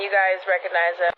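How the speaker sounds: usual speaking voice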